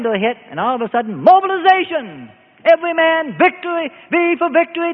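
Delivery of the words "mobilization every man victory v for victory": high, loud rallying call; rousing war cry. Acted. Nation suddenly hit by war, high rallying shout. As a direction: ring it out like a wartime rally.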